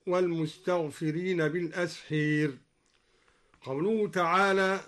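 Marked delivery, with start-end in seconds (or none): 0.1-2.5 بالأسحير ending "-heer" for -haar; imala. Shown as a demonstration. Teacher giving a further example, بالأسحير with imala. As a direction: tilt the last a of بالأسحير towards ee. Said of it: "وأمال أيضًا".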